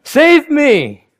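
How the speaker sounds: high, loud mock scream for help; screamed plea. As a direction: shriek it as she falls in. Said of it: "screams at him".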